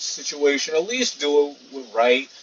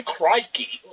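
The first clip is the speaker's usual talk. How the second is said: higher-pitched mock Australian accent; imitated Australian accent; 7 semitones higher than usual. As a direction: quote it with an Australian twang. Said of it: "That word sounds Australian".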